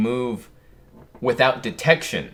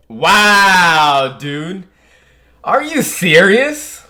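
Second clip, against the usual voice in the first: shocked disbelief; 6 semitones higher, 9 dB louder, much slower than usual. Real, not acted. Shocked by a manga reveal.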